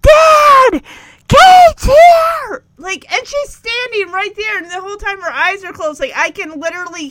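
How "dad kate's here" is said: high, loud yell for Dad. Retold or imitated. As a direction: call out across the house at full blast. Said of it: "puts his hands around his mouth and yells".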